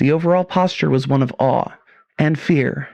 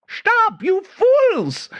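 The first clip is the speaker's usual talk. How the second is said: high shrill-free mock German shout, obviously fake; hammed-up fake German scientist yelling at the soldiers; fake German accent; much slower than usual. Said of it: "her German accent as thick as it was obviously fake".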